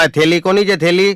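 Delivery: high, loud yelled question; shouting to the whole bus. Retold or imitated. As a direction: bellow it across the bus. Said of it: "મેં જોશથી રાડ પાડી".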